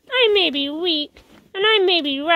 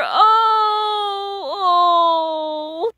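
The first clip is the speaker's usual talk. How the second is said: anguished cry; 2 semitones higher than usual. Acted. Villain's powers fade.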